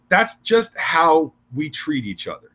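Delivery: ordinary delivery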